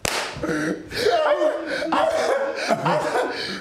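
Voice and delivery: high rolling laughter; long laugh. Real, not acted. After the punchline to his friend, long high laughter. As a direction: let the laugh roll on.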